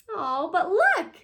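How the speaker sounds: plain reading voice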